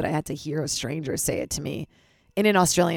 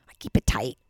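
whisper; 6 semitones lower than usual. Real, not acted. Whispered confession.

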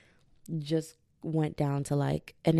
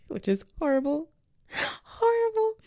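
loud squeal, far above usual pitch; high-pitched exclamation; 12 semitones higher, 6 dB louder than usual. Real, not acted. Podcaster joking about her best case scenario, shrill amused squeal. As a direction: squeal it in amused horror, climbing higher on the repeat.

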